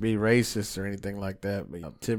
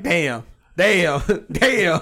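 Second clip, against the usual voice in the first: laughing imitation; 6 semitones higher, 6 dB louder, much slower than usual. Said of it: "and you were like".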